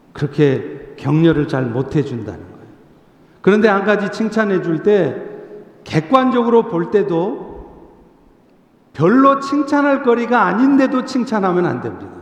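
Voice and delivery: ordinary delivery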